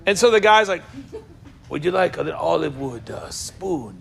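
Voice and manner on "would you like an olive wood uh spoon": low, sultry salesman imitation; hammed-up romantic sales pitch; passionate olive wood seller. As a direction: offer it like a seduction. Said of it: "almost like a romantic obsession".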